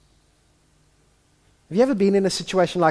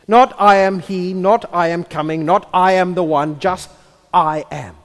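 louder, every "not" stressed; emphatic declaration; 5 semitones higher, 8 dB louder than usual. Acted. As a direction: hammer each "not" home. God emphatic about his name.

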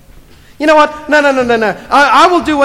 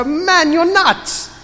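friend rebuking; 7 semitones higher, much slower than usual. Retold or imitated. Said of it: "give him a slap on the face and go".

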